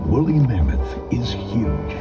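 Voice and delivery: ordinary delivery, nothing marked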